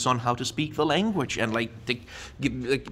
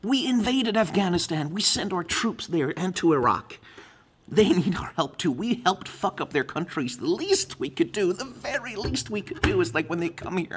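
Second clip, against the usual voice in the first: angry outburst; 8 semitones higher than usual. Real, not acted. Heated rant about refugees.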